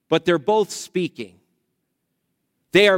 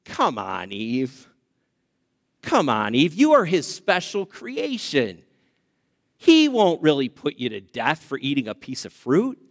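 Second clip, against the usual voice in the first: sly serpent coaxing Eve, low and hushed; 6 semitones lower than usual. Acted. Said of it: "he is saying to Eve".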